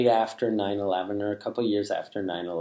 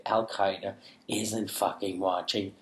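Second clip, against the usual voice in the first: ordinary talk, nothing marked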